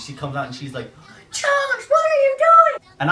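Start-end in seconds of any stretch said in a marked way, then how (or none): 1.3-2.9 very high, loud falsetto scolding; mock a horrified mother yelling; drawn-out, screechy mom impression; shrieking mom. Mom coming out, scolding him.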